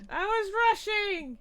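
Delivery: high, loud mock-defensive outcry; loud protest. Repeats excuse as loud outcry.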